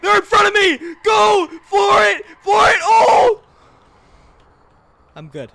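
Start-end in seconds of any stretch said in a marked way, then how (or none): 0.0-3.4 high, full-volume urgent yelling; panicked shout. Real, not acted. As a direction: yell at the driver to floor it. Enemies block the car.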